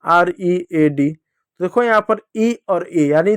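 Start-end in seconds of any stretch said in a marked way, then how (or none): 0.0-1.1 each letter spelled out slowly and apart; slow spelling. Shown as a demonstration. Teacher slowly spelling out रीड letter by letter. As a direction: spell the word letter by letter for the class. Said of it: "लेकिन अगर मैं लिख दूँ रीड".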